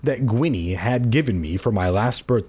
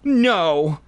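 loud, high horrified no; horrified howl; 6 semitones higher, 4 dB louder than usual. Acted. [name] escapes from under Keith with a horrified loud cry. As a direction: howl it in horror. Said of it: "I let out a horrified howl".

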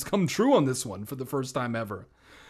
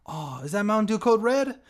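excited; 9 semitones higher, 6 dB louder than usual. Real, not acted. Joke aside, excited voice.

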